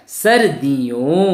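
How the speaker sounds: सर्दियों drawn out slowly: sar-di-yon; model pronunciation. Shown as a demonstration. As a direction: say सर्दियों slowly for learners to repeat. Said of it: "एक बार फिर से बोलिए". (सर्दियों को खींचकर, धीरे बोलें)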